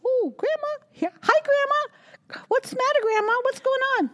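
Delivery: put-on high little-girl voice; young granddaughter asking what's wrong. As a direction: play the granddaughter, high and sweet.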